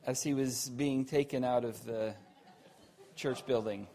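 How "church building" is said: ordinary talk, nothing marked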